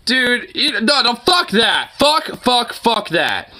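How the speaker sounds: high, insistent protest; indignant refusal. Real, not acted. Viewer hearing the gangsta ruling, indignant and high. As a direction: reject it outright, voice jumping up.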